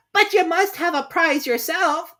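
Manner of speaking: high, slow squeaky mouse. Acted. Mouse insisting to Alice, high and slow.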